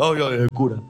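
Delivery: put-on a touch of a frightened drunk fleeing; mock fright. Drunk running from the priest, a hint of panic. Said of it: "decía".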